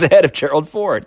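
normal talking voice

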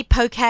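"poke" said as POH-kay; two-syllable pronunciation. Shown as a demonstration. Asking everybody to say it properly, "poke" as POH-kay. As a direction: say "poke" with a final "ay". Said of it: "It's pronounced poke".

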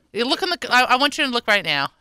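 ordinary talk, nothing marked

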